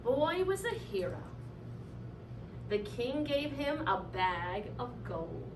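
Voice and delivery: plain reading voice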